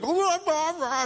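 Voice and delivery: high-pitched mocking babble; mock high chatter. Mocking the people ignoring the lady, high babble. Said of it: "you're like".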